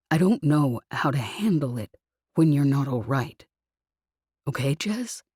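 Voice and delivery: comforting murmur; tender low voice. Acted. He speaks softly, gently.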